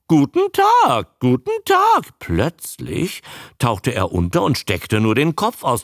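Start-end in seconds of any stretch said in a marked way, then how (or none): 0.1-2.2 slow, high, loud croak; drawn-out high creature voice; croaking newt. A narrator's reading. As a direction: squawk it back like a parroting newt. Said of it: "krächzte er".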